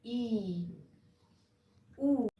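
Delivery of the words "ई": ई stretched into a long ee sound; ई को लंबा खींचकर बोलें. Shown as a demonstration. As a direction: hold ई out long and clear. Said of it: "long sound आएगा इसका".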